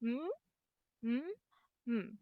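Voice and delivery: mm hummed with closed lips; mm sound demo. Shown as a demonstration. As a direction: hum mm together with the child. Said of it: "let's do it together this time".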